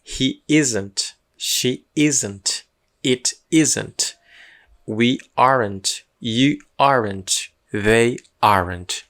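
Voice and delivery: very slow We aren't, They aren't, each word drawn out; slow pronunciation drill. Shown as a demonstration. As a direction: spell the sound out slowly for learners. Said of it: "Passa mais uma vez todos".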